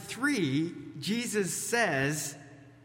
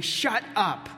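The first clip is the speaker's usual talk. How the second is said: louder, higher firm command; forceful command; 6 semitones higher, 5 dB louder than usual. Retold or imitated. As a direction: cut them off firmly. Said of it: "a forceful phrasing of the Greek".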